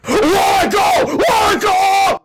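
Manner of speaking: very high, loud panicked yell; terrified roar. Acted. Villain carried into the sky, panicked yell. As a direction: shriek in terror. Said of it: "roared the terrified Turbo Two thousand".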